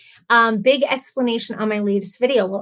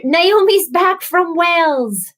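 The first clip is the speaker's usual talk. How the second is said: put-on drawn-out, higher-pitched go at a Welsh accent; singing out in a mock Welsh accent; attempted Welsh accent; 8 semitones higher, 3 dB louder, slower than usual. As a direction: playfully try a Welsh accent, badly. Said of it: "shouldn't even try to do an accent".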